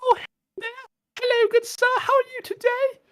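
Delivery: very high falsetto, polite and light; high disguise voice. Shown as a demonstration. Showing how he'd dodge fans, squeaky falsetto. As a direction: squeak it out like a dainty gentleman. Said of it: "maybe up the tone a little bit".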